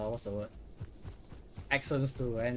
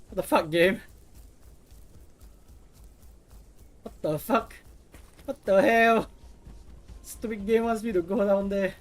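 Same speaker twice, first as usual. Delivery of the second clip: frustrated; 6 semitones higher, 4 dB louder than usual. Real, not acted. Frustrated at the game.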